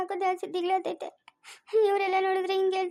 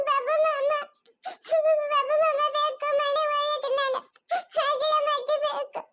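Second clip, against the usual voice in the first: put-on much higher, tearful little girl's pleading voice; a small girl begging to be taken to grandma; high, whimpering child voice; lost little girl; 11 semitones higher, 3 dB louder than usual.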